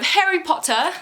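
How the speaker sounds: put-on British accent, badly done. Vlogger announcing the drawn character, bad British accent. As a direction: playfully fake a British accent on the name. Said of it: "That was a really bad British accent".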